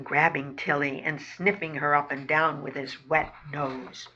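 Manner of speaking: plain reading voice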